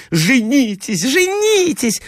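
high-pitched clamour of pleading ladies; crying out. A narrator's reading. Ladies urge the prince from all sides, high-pitched cries. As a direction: call it out high, rising on the repeat. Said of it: "закричали со всех сторон".

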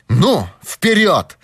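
firm command with a pause between words. A narrator's reading. Detective ordering the trembling girl on, commanding. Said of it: "приказал Пинкертон".

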